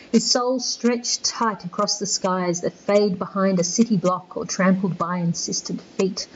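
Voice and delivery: soft, hushed poem recital; poem reading. Acted. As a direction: read the lines gently, letting them fall quiet. Reads Eliot lines aloud.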